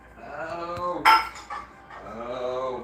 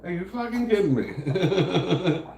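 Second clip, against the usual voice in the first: higher, slow, laughing through it; laughing disbelief; 6 semitones higher, slower than usual. Retold or imitated. To mom about her payment, slow, laughing disbelief. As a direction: let the laugh break into the words. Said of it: "I thought".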